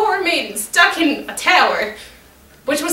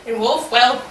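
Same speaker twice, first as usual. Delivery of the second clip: ordinary delivery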